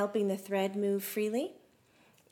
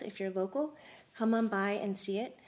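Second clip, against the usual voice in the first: normal talking voice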